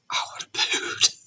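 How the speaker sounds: whispered aside, half-laughing. Real, not acted. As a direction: breathe it out as a whisper. Joking aside after scary story.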